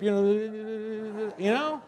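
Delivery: imitating a lip tremble before tears; mock quivering lip. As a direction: draw it out in a pretend blubber. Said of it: "Then the lip".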